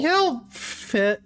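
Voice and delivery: high, brisk rabbit lady. Acted. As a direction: say it brightly, decided. GM voices Amantha.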